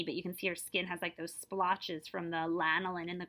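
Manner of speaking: usual speaking voice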